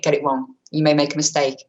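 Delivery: normal talking voice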